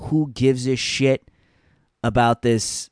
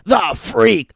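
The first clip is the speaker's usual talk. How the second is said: high, loud hammed-up hype call; over-the-top mock hype shout; mock announcer shout; 12 semitones higher, 7 dB louder, much slower than usual. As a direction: yell the catchphrase like a cheesy commentator. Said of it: "He's like".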